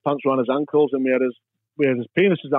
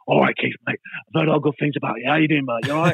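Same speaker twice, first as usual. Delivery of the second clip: Jason Statham; 3 semitones higher than usual. Retold or imitated. Film star greets the player at the awards, matey impression. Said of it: "That's a good impression".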